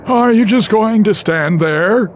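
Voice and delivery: shouting. Acted. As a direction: bellow it at the silent leader. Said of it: "shouted Aphex".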